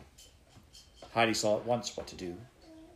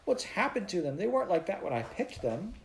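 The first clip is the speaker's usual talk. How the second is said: put-on much higher, dismayed girl's voice; a girl upset at her flowers, in a lightly voiced child; 9 semitones higher than usual. A narrator's reading. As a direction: wail over the faded flowers. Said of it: "She was terribly upset".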